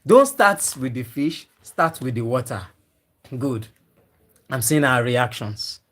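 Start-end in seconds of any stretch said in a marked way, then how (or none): 0.1-2.6 "Don't" hit hard, "start with the water" dropping away; firm contrast of "fish" and "water", stressing "Don't"; emphatic correction. Real, not acted. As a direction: punch "Don't", lift "fish", let "water" fall. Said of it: "That's my emphasis".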